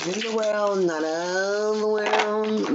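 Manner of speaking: slow, dreamy repeat; slow and drawn out. Acted. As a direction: stretch out not of the world slowly. Repeats the gospel line.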